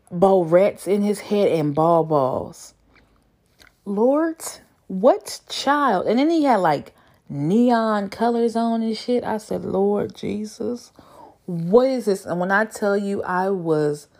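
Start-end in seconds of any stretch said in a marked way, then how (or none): 3.9-6.1 incredulous. Real, not acted. Reacting to man's barrettes. 9.6-12.3 slow incredulous. Retold or imitated. Retells her reaction to profile.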